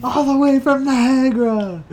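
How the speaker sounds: high, drawn-out announcer call; Matt Devlin. Acted. Fan imitates the broadcaster naming a random city, high slow call. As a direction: stretch it out like a commentator. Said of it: "Matt, Matt Devlin".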